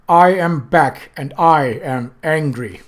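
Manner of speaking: slow, loud, cold fury; measured menace; Death, cold anger. Acted.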